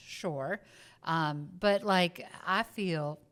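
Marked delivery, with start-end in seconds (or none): 2.5-3.2 southern drawl slipping out, drawn out; southern accent. Real, not acted. Southerner discussing accents lets their own slip, southern drawl. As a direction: let a slow southern twang slip in. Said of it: "there's a country accent".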